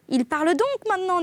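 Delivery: high-pitched, wondering question; surprised question. A narrator's reading. Young brother Jacques asking about the parrot, surprised high voice. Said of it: "dit Jacques".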